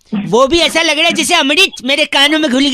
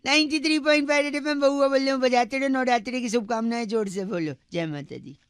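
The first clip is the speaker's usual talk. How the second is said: quick, fading radio outro; radio host sign-off; 9 dB quieter than usual. Acted. Radio host signing off to listeners, a quick fading outro. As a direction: wrap up the prank like a DJ.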